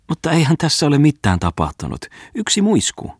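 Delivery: high-pitched wifely protest; indignant protest. A narrator's reading. Wife dismissing a kiss to her husband, high indignant protest.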